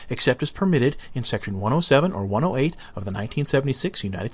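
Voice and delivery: rattled off quickly; fast disclaimer read. Acted. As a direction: rush the legal text.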